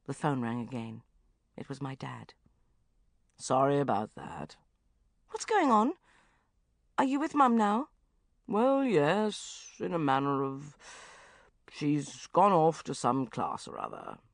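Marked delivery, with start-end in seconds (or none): 3.4-14.2 stiff father. Acted. Father voiced, low.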